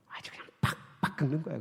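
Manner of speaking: hushed, breathy aside; whisper. Real, not acted. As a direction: drop to a secret whisper. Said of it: "whispered aside".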